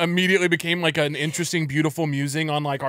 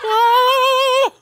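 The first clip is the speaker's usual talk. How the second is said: very high, loud playful shriek; mock scream; 9 dB louder than usual. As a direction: scream like a wobbling skater about to crash. Mock scream on skate with pizzas.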